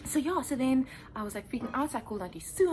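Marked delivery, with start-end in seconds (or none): none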